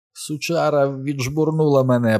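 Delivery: high, slurry just-woken voice; dazed, drowsy grumbling; groggy mumble. A narrator's reading. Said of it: "пробелькотів Річі, наче щойно прокинувся".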